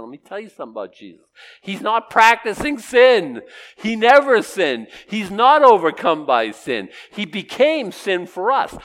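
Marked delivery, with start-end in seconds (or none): 1.6-8.8 loud, high, deliberate proclamation; ringing pulpit declaration; emphatic preaching. Real, not acted. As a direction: hammer each statement out slowly to the congregation. Preaches sinlessness at full force.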